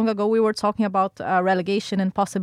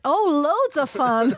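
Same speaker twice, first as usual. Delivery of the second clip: higher, slower, keen "loads of fun"; enthusiastic; 6 semitones higher, slower than usual. Shown as a demonstration. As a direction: stretch out "loads" with real delight. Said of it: "Okay, I'll rephrase that".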